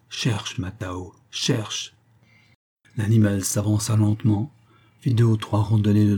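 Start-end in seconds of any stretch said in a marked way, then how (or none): 0.1-1.9 «Cherche, Matao» doux, bas et lent; douce incitation au chien. Acted. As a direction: encourager le chien à mi-voix, lentement. Young man urges dog softly. (le jeune homme pousse doucement le chien à flairer, voix basse et lente)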